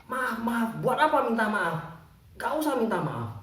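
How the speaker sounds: mock annoyed janitor; angry. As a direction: brush off an apology crossly. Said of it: "Marah bapak ini".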